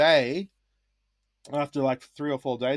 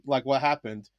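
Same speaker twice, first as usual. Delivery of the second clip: usual speaking voice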